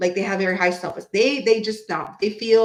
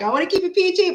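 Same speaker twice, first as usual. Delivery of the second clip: a much higher, light impression of Archer; imitating Archer; 9 semitones higher, much faster than usual. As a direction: mimic the friend keeping it clean.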